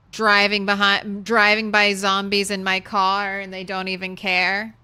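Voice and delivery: ordinary talk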